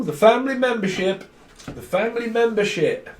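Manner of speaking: high-pitched hooted phrase; mock fanfare. Reader mocking the family membership, high hooted voice. Hoots the phrase after a whoop.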